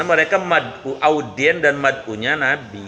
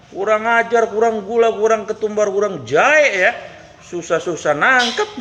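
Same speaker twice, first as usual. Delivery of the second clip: furious rant; 7 semitones higher than usual. Acted. Hunter rants at the Prophet.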